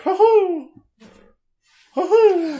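high, playful "hoo-hoo" whoop; roguish guy. Retold or imitated. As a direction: whoop it up like a dashing rogue. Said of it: "the roguish guy who's like".

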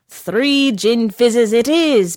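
loud, slow bellow. A narrator's reading. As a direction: boom it across the bar. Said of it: "bellowed the barman".

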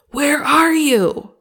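put-on ticked-off father shouting for his hiding children; angry dad. Shown as a demonstration. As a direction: bellow it like a dad whose kids broke something. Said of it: "like a really angry dad who's ticked off with his kids".

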